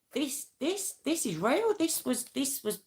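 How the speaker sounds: high, hushed disbelief breaking through; the truth suddenly dawns on you; stunned realisation. Real, not acted. Telling viewers she realised the film was real, stunned and hushed.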